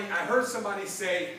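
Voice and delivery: usual speaking voice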